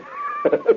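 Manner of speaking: a quick high-pitched laugh; high giggle. Real, not acted. Host giggling at his own story. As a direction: burst out giggling.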